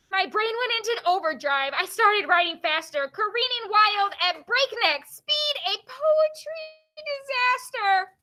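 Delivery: builds to a high, stretched final line; dramatic poem reading. Acted. Teacher reads the overdrive poem, dramatic poem reading rising at the end.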